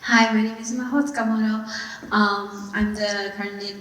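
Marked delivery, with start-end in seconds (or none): none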